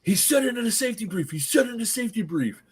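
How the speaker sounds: high-pitched mock alarm; agitated realisation. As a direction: imitate players suddenly catching on. Players' sudden realisation, acted out.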